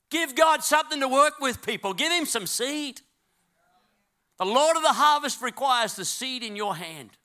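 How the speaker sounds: high, loud preacher exhorting the crowd; full-force preaching. Real, not acted.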